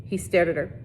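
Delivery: ordinary delivery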